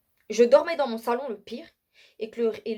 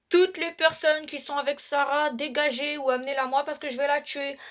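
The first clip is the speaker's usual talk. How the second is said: devil voice; 5 semitones higher than usual. Retold or imitated. The devil on the store speaker threatens the shoppers, a menacing voice. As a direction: voice the devil threatening to kill Sarah. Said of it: "il y avait la voix du diable".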